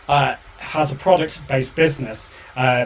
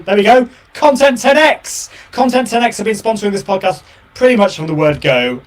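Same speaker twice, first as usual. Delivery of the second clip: excited sponsor intro; 7 semitones higher, 5 dB louder than usual. Acted. Launching into a sponsor read.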